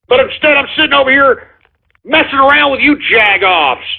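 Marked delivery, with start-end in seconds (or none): none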